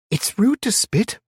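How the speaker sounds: put-on high, slow, prim child's scolding; scolding little girl. A narrator's reading. Little girl scolds the stranger for spitting, high and prim. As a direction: tell him off like a bossy ten-year-old. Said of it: "she scolded me".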